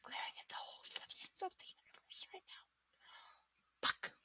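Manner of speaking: a breathless unvoiced whisper. Real, not acted. Streamer whispers her glare at the universe. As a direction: mutter it under your breath. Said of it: "I'm glaring".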